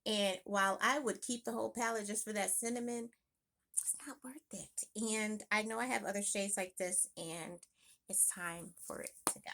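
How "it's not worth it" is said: unvoiced whispered aside. Real, not acted. Deciding to toss the palette, whispered aside. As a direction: mutter it to yourself in a whisper.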